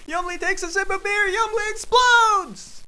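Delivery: very high narration bursting into a shout; climactic shriek. A narrator's reading. Narrator reaches Yumbly's explosion, shrieking. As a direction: build to a screamed, stretched final word.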